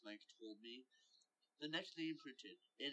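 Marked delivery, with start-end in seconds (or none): none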